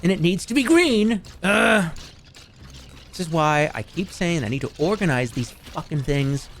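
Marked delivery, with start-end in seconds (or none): 0.0-1.9 frustrated Lego builder. Retold or imitated. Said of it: "It's like".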